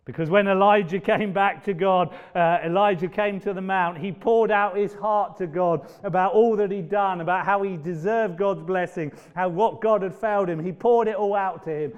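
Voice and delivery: loud, high preaching build; impassioned sermon delivery; full-force preaching. Real, not acted. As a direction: preach it out with fire.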